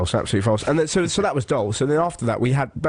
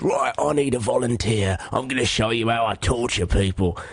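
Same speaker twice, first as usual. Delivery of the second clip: dungeon torturer. Retold or imitated. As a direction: imitate a torturer addressing the crowd. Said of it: "he said".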